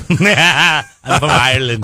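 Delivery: slow, put-on Irish accent, flat and dry; mock Irish deadpan. Offended Irishman correcting the American, mock Irish accent. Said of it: "deadpan look".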